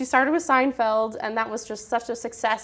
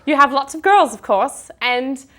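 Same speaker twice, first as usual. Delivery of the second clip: animated, louder put-on British accent; playful British accent, performed on the spot; 4 semitones higher, 6 dB louder than usual. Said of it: "in a British accent".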